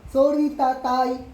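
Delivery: a father mimicking his son's shouted sorry, loud and slow; mock shouted sorry. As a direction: imitate the child yelling his apology. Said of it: "lakas ng boses mo eh".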